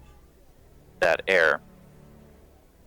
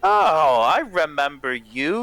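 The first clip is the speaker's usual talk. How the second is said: high-pitched, stretched realization, then eager; light up as you recognize them; high, delighted burst of recognition; surprised recognition; 8 semitones higher, 3 dB louder than usual. Acted. Nikolai suddenly recognizes the guests.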